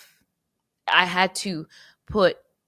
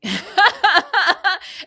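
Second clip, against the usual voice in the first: sudden loud laugh, pitched way up; high laugh; 10 dB louder than usual. Real, not acted. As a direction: burst out laughing at yourself. Audience reaction surprises her.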